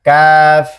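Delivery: কাফ light, a little forward of qaf, repeated; Arabic kaf demo. Shown as a demonstration. Teacher contrasts kaf with qaf for students, light and clear. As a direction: say কাফ lightly with the tongue just ahead of the root. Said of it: "লাগায়া কাফ".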